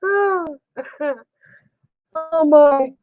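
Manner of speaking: high, helpless ohs between laughs; embarrassed laughter. Real, not acted. Woman teased by Kathy, embarrassed laughter. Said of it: "Now you've embarrassed me".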